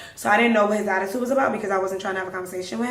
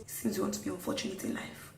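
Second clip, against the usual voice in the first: hushed grumble under the breath; imitated muttering; 2 semitones lower, 15 dB quieter, faster than usual. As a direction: mutter it, barely voiced, imitating the driver. Driver mutters at his mirror.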